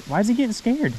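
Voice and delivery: baffled, voice shooting high; surprised disbelief. Real, not acted. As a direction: pitch jumps way up in disbelief. Fish backs off the bait.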